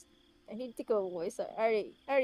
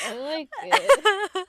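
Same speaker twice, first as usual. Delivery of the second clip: loud, high, drawn-out imitation of forced praise; teasingly exaggerated fake approval; mock gritted teeth; 8 semitones higher, 10 dB louder, much slower than usual. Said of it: "gritted teeth".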